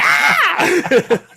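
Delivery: loud high scream; high-pitched mock shriek, full out; demonstrated scream. Shown as a demonstration. Storyteller shows the scream he could have given, screaming. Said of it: "Well, I didn't scream".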